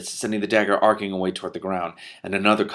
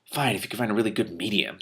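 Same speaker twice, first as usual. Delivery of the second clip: ordinary delivery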